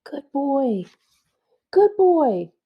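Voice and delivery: put-on girl calling her dog. Girl calls her hurt dog in a high voice. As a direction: call out to the lost dog, hopeful.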